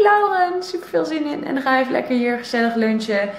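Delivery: ordinary delivery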